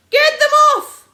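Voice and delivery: very high, loud scream. A narrator's reading. Landowner screaming as birds land on his car. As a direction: panic over the car. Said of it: "screamed Mister Hazel".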